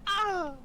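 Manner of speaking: high piercing movie death scream; Wilhelm scream. Retold or imitated. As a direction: belt out a stock death cry. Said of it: "the Wilhelm scream is, like, I can't imitate it".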